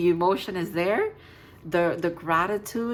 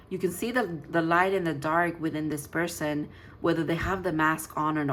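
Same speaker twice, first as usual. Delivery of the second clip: ordinary talk, nothing marked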